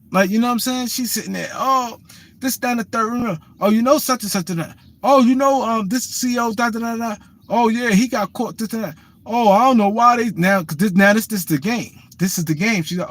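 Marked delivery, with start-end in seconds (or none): 1.5-10.3 imitating her higher-pitched prattle; mock her endless gossipy rambling; woman talking crazy. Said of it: "she sitting there talking crazy shit".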